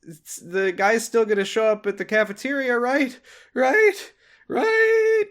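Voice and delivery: rising panic, begging for agreement; anxious pleading. Retold or imitated. Freddy pleading as Billy leaves.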